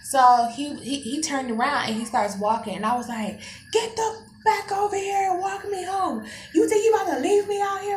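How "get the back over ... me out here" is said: high-pitched indignant rant; acted angry high-pitched demand; going off. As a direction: re-enact going off at him, high and whiny. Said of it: "I'm just going off".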